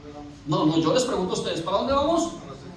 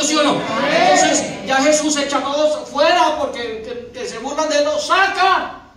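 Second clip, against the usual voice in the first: loud, higher preaching drive; full-force preaching; 5 semitones higher, 6 dB louder than usual. Real, not acted. Preacher driving the story home to the church, loud.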